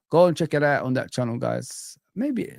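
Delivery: ordinary delivery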